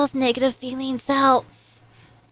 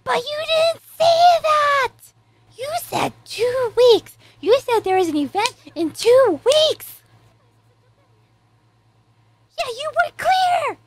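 much higher, protesting at the screen; indignant protest; 9 semitones higher than usual. Real, not acted. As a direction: call the character out. Arguing with the screen character.

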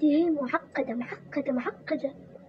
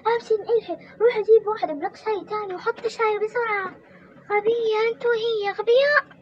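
high, loud barked orders; angry mother-in-law; 10 semitones higher, 6 dB louder than usual. Acted. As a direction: snap orders and insults at them. (الحماة تصرخ بالأوامر والشتائم)

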